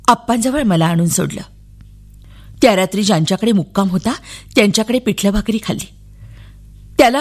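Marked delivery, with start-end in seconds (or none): none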